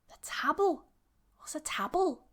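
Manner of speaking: high, baffled echo question; puzzled listener. Pretend. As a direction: play someone who has no idea what that word is. Acting the confused listener.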